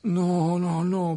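Harry refusing Lockhart's offer to fix his arm; protesting. A narrator's reading. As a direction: push back, refusing the help. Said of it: "protesta Harry".

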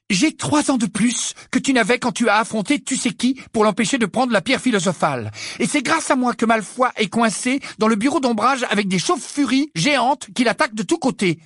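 plus haut, plus fort, fougueuse; avec fougue. Acted. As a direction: lancer la tirade avec ardeur. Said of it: "l'interrompit avec fougue". (Ginny coupe Harry, avec fougue)

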